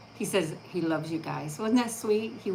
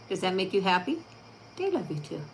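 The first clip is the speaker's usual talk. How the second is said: ordinary talk, nothing marked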